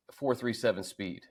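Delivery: ordinary delivery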